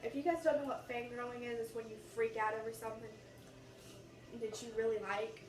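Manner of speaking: ordinary talk, nothing marked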